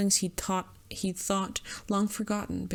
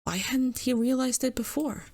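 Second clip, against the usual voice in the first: ordinary talk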